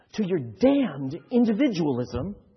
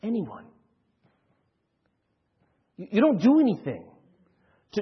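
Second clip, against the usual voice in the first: normal talking voice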